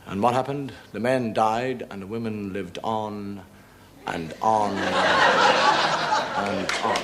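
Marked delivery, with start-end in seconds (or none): 4.1-7.0 stretched slowly while laughing; laughing drawl. Real, not acted. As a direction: drag it out, laughing. Punchline drawn out.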